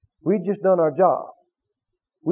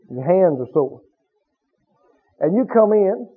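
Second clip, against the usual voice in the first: ordinary talk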